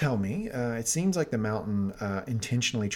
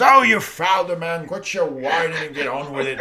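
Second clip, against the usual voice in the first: louder, brash, impatient royal announcer voice; King Bobby B as announcer; 4 semitones higher, 7 dB louder than usual. Retold or imitated. The king calls a basketball game, brash and impatient. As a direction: bark it like a fed-up drunk king calling a game. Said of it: "if he called basketball games".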